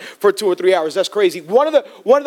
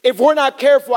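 usual speaking voice

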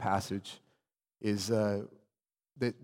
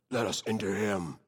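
low demon voice, put on for fun; demonic voice; 3 semitones lower than usual. Shown as a demonstration. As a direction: slip into a demon's lowered voice. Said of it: "my demonic voice".

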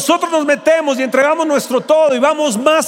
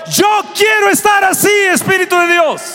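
fervent cry; 7 semitones higher than usual. Real, not acted. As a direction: cry out to God with all your force. Pastor cries out in prayer.

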